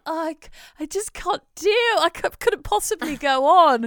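high, hammed-up despairing wail; dramatic toddler. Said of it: "it's really dramatic".

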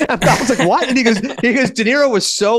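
high voice, cracking up at the punchline; laughing through telling. Real, not acted. Host telling the Powell dinner punchline, laughing. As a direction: barely get the story out for laughing.

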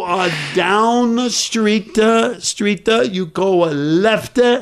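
hammed-up Italian fumbling for English words; fake Italian accent. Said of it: "now I get to pretend I'm Italian".

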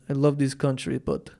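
put-on low-pitched amateur Russian accent; loosely put-on Russian accent; rough Russian accent. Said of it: "I can't do a Russian accent".

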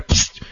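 short hissed "psst"; imitated air spray. Shown as a demonstration. Trainer mimics spraying a misbehaving dog, quick psst. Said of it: "spray with the air".

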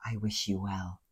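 very low, hushed imitation; retold whisper. Sinister courtroom parting words into his ear, imitated whisper. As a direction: murmur it softly, as if into an ear. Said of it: "whispering into her, into the man's ear".

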